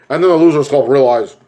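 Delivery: usual speaking voice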